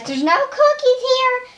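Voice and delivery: high pretend grandpa answering Vader. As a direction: play Grandpa as a toy character, high and squeaky. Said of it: "like Grandpa says".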